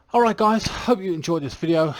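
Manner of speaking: breathless, winded sign-off; out of breath. Real, not acted. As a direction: talk while out of breath. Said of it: "My God, I'm out of breath".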